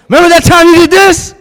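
high, loud mock accusation; acted accusing shout. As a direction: fling it like an old grievance in a quarrel. Acts out spouse dredging up past wrongs.

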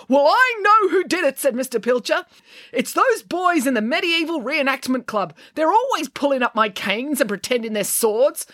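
high-pitched, worked-up grumbling; aggrieved groundskeeper voice; indignant complaint. A narrator's reading. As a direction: rant about the culprits. Angry groundskeeper goes on complaining.

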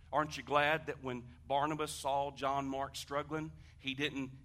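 ordinary talk, nothing marked

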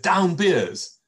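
high, loud warning call of down beers; sudden urgent shout. Retold or imitated. Friend spots a police car, warns the others with a loud high shout. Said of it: "Jim suddenly said".

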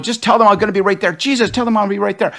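quick, high, hurried orders; brushing off the assistant while running late, flustered. Retold or imitated. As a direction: rush it out like you're late. Rushing assistant from doctor's office.

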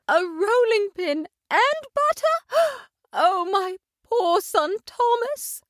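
high, gasping cry of a mother cat; fearing for her son; alarmed exclamation. A narrator's reading. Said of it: "exclaimed Tabitha".

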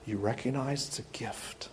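hushed, almost breathless; gentle whispered reassurance; soft whisper. Acted. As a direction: lean in and whisper it. Soft pastoral aside.

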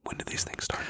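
whispered echo of the question. Pretend. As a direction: breathe the question back softly. Repeats cue in a whisper.

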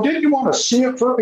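high, amazed asking; incredulous question. Retold or imitated. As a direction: ask in disbelief, pitch up high.

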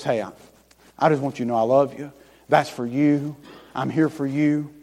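soft, low, gentle whisper-close; a soft tender divine whisper; tender voice of God. Acted. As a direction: speak softly as if God beside you. God speaking tenderly in sermon.

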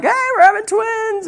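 very high, squealed mock excitement; retold excited announcement. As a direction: squeal happy news down the phone. Retold call announcing twins to parents.

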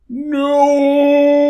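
high, loud shrinking wail; demon's cry. Acted. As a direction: wail while being imprisoned. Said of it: "the demon cried".

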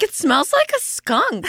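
high mom voice; imitating mom. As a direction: mom complaining about the smell. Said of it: "So she's like".

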